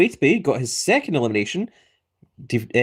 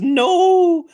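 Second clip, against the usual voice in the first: much higher, loud mock wail; Wapas's anguished no; 5 dB louder than usual. Voicing the eliminated player's chat as a loud high cry. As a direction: howl it like the losing player. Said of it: "Wapas says".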